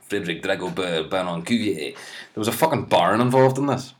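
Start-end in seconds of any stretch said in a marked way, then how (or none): none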